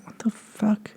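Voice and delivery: hushed, disbelieving aside; stunned muttering. Retold or imitated. Airman left alone by sponsor, stunned muttering. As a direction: say it under your breath, baffled. Acted stunned reaction after being abandoned.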